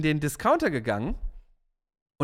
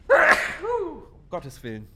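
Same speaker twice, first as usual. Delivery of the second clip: dramatic exclamation; 11 semitones higher, 4 dB louder, much slower than usual. Real, not acted. Outburst right after sneezing.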